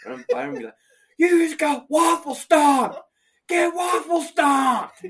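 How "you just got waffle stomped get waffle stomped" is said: high, loud, slow trash-talk shout; shouting a taunt in Call of Duty; gamer taunt yell. Pretend. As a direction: yell it at an online opponent. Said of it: "gonna yell that at people".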